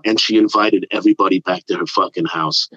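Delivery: normal talking voice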